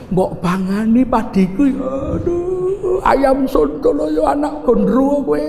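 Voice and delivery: slow, mock-angry curse at the chickens in Javanese; angry cursing. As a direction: scold the hens, drawn out and annoyed. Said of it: "dengan rasa marah". (marah pura-pura mengumpat ayam)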